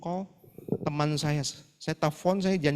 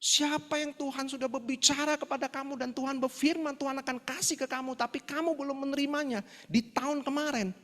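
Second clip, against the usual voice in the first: high-pitched preacher's call to the congregation; sustained, raised sermon delivery; preacher delivering a sermon; 8 semitones higher than usual. Retold or imitated. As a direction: imitate a pastor preaching on TV. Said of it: "ada satu pendeta itu lagi khotbah".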